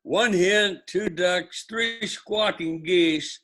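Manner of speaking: high, slow, loud singsong recital; sing song rote recitation. Shown as a demonstration. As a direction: draw out each item like a chant. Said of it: "Repeat after me".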